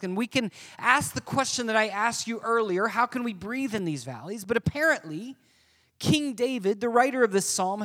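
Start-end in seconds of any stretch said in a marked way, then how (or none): none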